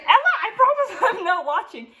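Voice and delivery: high, laughing mock teacher voice; imitating the ballet teacher coaxing her with a laugh. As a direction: gently tease the shy student into dancing. Teacher voiced, coaxing shy student.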